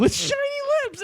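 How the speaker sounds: squeaky high mocking echo. Mocking the huffers, squeaky high voice.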